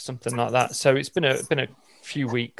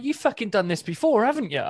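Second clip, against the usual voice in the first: raised, exasperated "you've done this before"; indignant accusation; 5 semitones higher than usual. Retold or imitated. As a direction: call him out, half laughing. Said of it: "I'm like".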